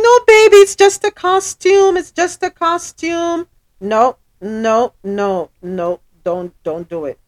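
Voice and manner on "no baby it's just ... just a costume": mock soothing parent. Mocking parent soothing crying child.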